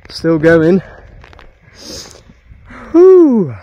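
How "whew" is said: usual speaking voice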